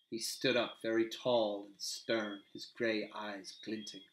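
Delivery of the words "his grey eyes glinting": plain reading voice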